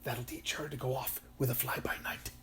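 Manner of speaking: gossipy, mocking whisper; sniggering whisper. A narrator's reading. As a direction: whisper it slyly among yourselves. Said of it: "trees sniggering quietly".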